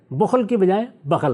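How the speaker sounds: first بخل as "bukhl", second بخل as "bakhal"; u vowel in first بخل, a vowels in second; contrasting two readings. Shown as a demonstration. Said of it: "تلفظ میں تبدیلی آ گئی".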